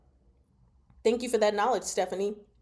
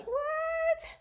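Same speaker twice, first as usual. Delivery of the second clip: 1 shocked what. Real, not acted. Disbelief at the reading.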